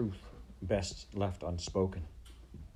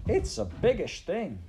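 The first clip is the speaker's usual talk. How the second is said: put-on high, bobbing serving-girl voice; Midge; 12 semitones higher, 4 dB louder than usual. A narrator's reading. Serving girl wearing the heavy crown, high voice. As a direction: say it high and sing-song.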